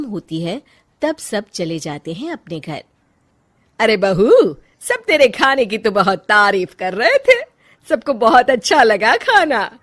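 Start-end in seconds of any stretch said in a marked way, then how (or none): 3.8-9.8 loud, lilting matriarch voice; lightly hammed-up, animated mother-in-law; pleased mother-in-law. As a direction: gush over the compliments. Mother-in-law passes on praise.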